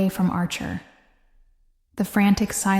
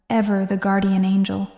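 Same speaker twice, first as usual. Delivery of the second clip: ordinary delivery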